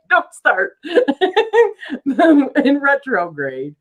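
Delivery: high-pitched giggling through the words; real laughter. Real, not acted. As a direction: crack up, then try to carry on through the laugh. Cracking up over the Uranus joke.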